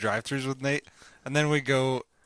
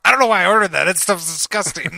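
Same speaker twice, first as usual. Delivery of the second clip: loud, higher, hammed-up regret; mock disgust; 7 semitones higher, 10 dB louder than usual. Friend mocking Nate's regret over his own drive-thru drink, loud and hammed-up. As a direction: blurt it out like instant buyer's remorse.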